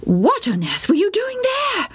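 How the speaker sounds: high, incredulous mother's question; exasperated mother. A narrator's reading. Mother asking Joe why he was there, high and incredulous. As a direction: demand an answer like a shocked mum.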